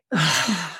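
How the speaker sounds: long audible sigh out; exhale with a sigh. Shown as a demonstration. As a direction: let a big sigh go. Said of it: "exhale with a sigh".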